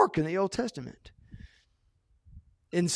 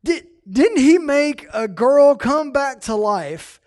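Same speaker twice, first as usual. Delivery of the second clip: normal talking voice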